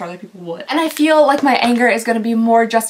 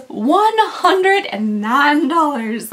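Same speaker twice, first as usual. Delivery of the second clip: slow, drawn-out announcement; slow, emphatic delivery of the figure; appalled price reveal; 2 semitones higher, much slower than usual. Real, not acted. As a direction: draw the price out in disbelief.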